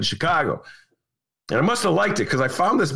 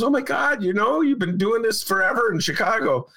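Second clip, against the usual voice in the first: high-pitched retold astonishment; imitated amazement; 10 semitones higher than usual. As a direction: imitate her marvelling, voice lifted high. Retells colleague's amazed reaction.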